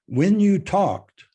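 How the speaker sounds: a touch of hammed-up dominance; domineering voice. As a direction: lean on it like a bossy teen CEO. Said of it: "you did it in a domineering voice".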